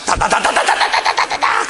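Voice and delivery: rapid loud babbling tongues; speaking in tongues. Retold or imitated. A preacher reenacting his first tongues, loud rapid babbling. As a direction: let the syllables pour out fast. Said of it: "방언이 터지면서".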